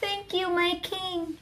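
high, soft mouse voice; small, meek storybook mouse; little mouse. Acted. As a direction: thank the king in a tiny high squeak. Mouse thanks the lion, mouse voice.

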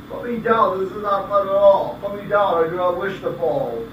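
high, loud complaining fish; shrill protest. Acted. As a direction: protest loudly in alarm.

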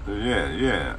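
normal talking voice

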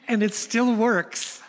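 ordinary talk, nothing marked

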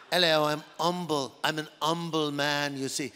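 put-on voice, H dropped from "humble"; affectedly humble man. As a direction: play a falsely humble character saying "umble". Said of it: "so humble, I drop the H".

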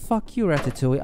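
loud, high yell at the rat; angry yell. Real, not acted. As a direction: shout it at the game. Yelling at the game rat.

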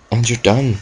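ordinary talk, nothing marked

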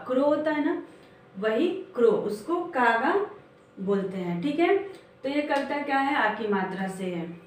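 ordinary talk

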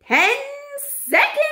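very high, loud, stretched witch cry; shrieking witch countdown. A narrator's reading. Grand High Witch counting down onstage, shrieking.

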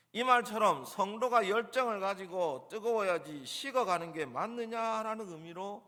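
ordinary talk

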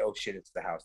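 low, soft quick quote; hushed film line. Retold or imitated. Quotes his film line again.

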